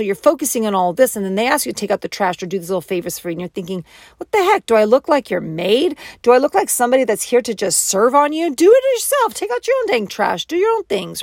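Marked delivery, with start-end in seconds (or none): 4.2-11.2 hammed-up irritated partner; fed-up outburst. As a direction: snap back at the request in mock outrage. Fed-up partner refusing chores.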